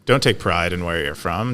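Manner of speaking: normal talking voice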